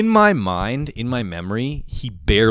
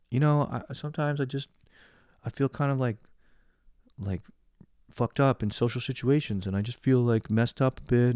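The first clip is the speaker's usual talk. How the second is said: timid self; 3 semitones higher, 5 dB quieter than usual. Retold or imitated. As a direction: mumble it out, embarrassed and unsure. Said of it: "you know, I'm so timid".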